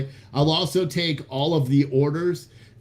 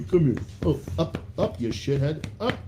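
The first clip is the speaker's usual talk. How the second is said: usual speaking voice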